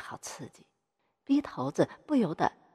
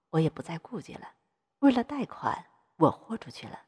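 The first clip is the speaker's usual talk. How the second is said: ordinary delivery, nothing marked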